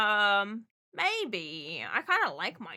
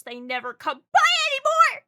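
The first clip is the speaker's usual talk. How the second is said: high, loud hammed-up wail; theatrical shouted grievance; mock shout; 14 semitones higher, 11 dB louder than usual. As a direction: complain loudly in jest. Mock complaint about viewer.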